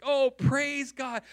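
a hint of joyful relief; lightly voiced relieved friend; relieved praise. Friend relieved at good report.

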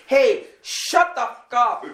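slow, high mock yell; high drawn-out mock shout; retold yell. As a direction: play the angry driver yelling back. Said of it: "she yells back".

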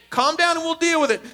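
acted parent trying to settle a meltdown; parent calming. Parent answering a wailing kid, firm calming. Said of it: "you say to this to them".

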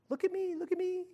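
put-on small, high childish plea; attention-seeking child. Children just wanting attention, high childish begging. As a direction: imitate a needy kid in a high, soft voice. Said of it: "a bunch of children just wanting attention".

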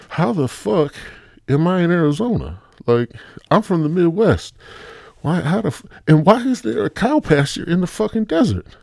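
high, exasperated disbelief; acted bewilderment. Abductee to police in Arizona, incredulous acted protest.

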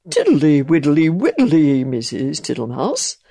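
loud, jolly toad voice; a big toad humming happily; smiling toad. A narrator's reading. Toad greeting the mouse, loud and jolly. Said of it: "said the smiling Mister Jackson".

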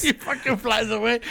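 normal talking voice

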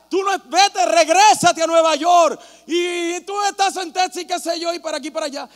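hostile fans. Retold or imitated. Preacher imitating hostile fans telling him to go back, loud. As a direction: tell the outsider to go home. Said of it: "la gente me decía".

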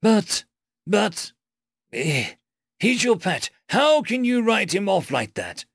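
high, halting, appalled protest; agitated high-pitched sputtering; flustered stammer. A narrator's reading. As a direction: stammer the start, then burst out in dismay. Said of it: "stammered Biograt".

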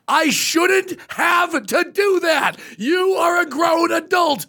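high, drawn-out mock scolding of a partner; exasperated scolding. Wife arguing with husband over chores, mock exasperated. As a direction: lay down each word slowly, like a fed-up wife. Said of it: "her whole argument was".

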